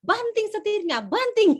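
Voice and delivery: high, loud barked orders while parking; Medan neighbour shouting. Retold or imitated. Medan father ordering his son to park, high loud yell. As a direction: yell it like an angry-sounding parent. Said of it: "dia ngomong nih".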